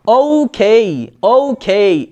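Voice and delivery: slow British "ওকে" with ou and khei; British-style okay. Shown as a demonstration. Teacher repeating how the British say it, British accent. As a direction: draw out a British okay, puffing the k. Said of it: "এরকম বলে".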